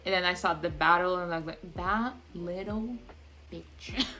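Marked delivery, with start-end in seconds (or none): 1.8-4.0 slow, soft seething insult; contemptuous. Retold or imitated. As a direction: spit it out under your breath. Seething at the villain.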